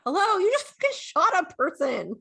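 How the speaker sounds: high, loud mock disbelief; incredulous outburst. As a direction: exclaim it as if nobody gets it. Imagined outburst at nonchalant shooter.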